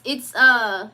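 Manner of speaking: normal talking voice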